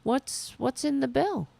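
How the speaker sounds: usual speaking voice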